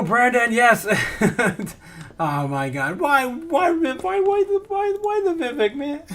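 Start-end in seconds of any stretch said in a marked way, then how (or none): 3.0-6.0 high, whiny fake wail, much higher; mock crying whine. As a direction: whine like you're about to cry, hammed-up. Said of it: "You're gonna make me cry".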